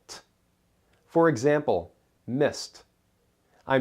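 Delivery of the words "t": a lone crisp T sound, no vowel; isolated t consonant. Shown as a demonstration. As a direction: make just the t sound. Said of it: "might have the T sound".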